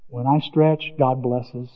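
ordinary talk

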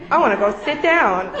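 laughing through the words. Real, not acted. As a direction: giggle at your own awkwardness. Laughing at her own awkwardness.

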